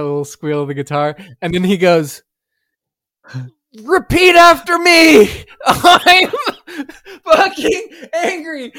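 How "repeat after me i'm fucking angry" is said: high, loud, slow bellowed command; hammed-up raging chant; mock furious shout. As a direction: bark it at the whole hall. Bandmate commands crowd to repeat.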